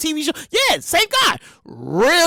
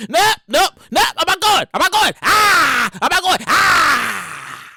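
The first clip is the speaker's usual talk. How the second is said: mock panicked refusal; 2 semitones higher, slower than usual. Comic refusing to visit those states, mock panic. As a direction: refuse over and over, yelping between.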